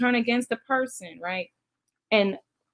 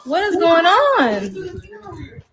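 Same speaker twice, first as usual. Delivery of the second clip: high, loud and slow shocked question; stunned disbelief; 9 semitones higher, 5 dB louder, much slower than usual. Real, not acted. Watching the house fight erupt, loud shocked disbelief. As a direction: drag it out in alarmed shock.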